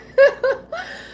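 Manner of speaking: high, bursting laugh through emotion; a real, overcome little laugh; emotional laugh. Real, not acted. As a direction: let a high laugh break out. Laughs after getting emotional.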